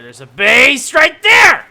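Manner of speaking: loud, slow excited shout. Real, not acted. Spotting a base, a loud excited shout. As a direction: yell the discovery.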